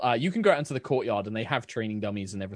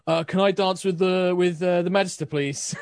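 lightly voiced eager dancer, higher and louder; Aaron, eager; 5 semitones higher, 4 dB louder than usual. Aaron asks to dance with the Magister, eager higher voice. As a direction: blurt out a hopeful request. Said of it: "Aaron immediately starts, like, looking towards Azara".

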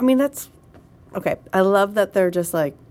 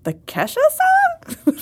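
incredulous; 3 dB louder, much slower than usual. Retold or imitated. Incredulous reply to son.

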